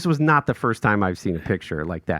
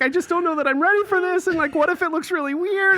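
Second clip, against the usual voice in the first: imitating crying wife. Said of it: "she starts crying".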